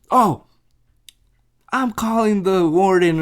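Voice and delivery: lightly acted official warning; threatening. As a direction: act out a guard raising the alarm. Retold guard threatens the men.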